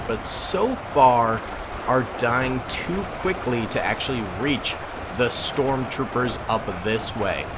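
normal talking voice

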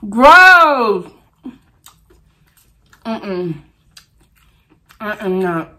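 normal talking voice

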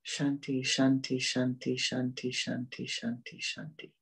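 steady repeated mantra fading into softness; soothing mantra. Shown as a demonstration. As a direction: let the chant die away to almost nothing. Chanting a mantra to quiet the mind.

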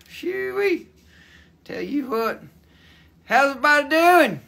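a country bumpkin voice, lightly put on; hammed-up hillbilly drawl. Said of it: "I got to, you know, talk funny".

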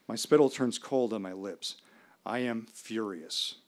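ordinary delivery

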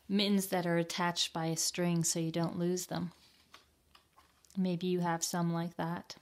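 plain reading voice

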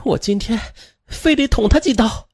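high-pitched, seething narrated threat; vicious vow to a partner, pitched high. A narrator's reading. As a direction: spit out the threat with venom. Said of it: "恶狠狠地对男友说".

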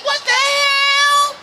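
high, drawn-out sung shriek of disbelief; shocked shriek. Retold or imitated. Comic hearing the worker's choice, high sung shriek. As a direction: wail it out high and slow in shock. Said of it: "I said".

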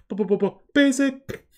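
high, loud stuttered build into basic; a loud goofy rapper impression; imitating the rapper. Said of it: "now I can't stop saying it".

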